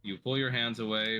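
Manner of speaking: ordinary talk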